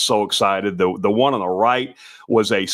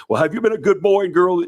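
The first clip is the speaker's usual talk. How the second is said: lightly put-on Santa voice, pitched up; Santa voice for kids; 8 semitones higher than usual. Shown as a demonstration. Santa asking the kids if they've been good, lightly put-on voice. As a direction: demonstrate a light Santa greeting for children. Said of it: "I will kinda deepen my voice and talk to the kids".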